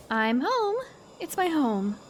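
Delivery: a hint of a young girl's voice; lightly voiced girl. Shown as a demonstration. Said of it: "what kind of voice does she have?".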